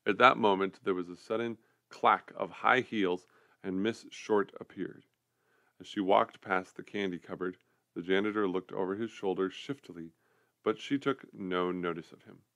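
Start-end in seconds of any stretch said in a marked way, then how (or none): none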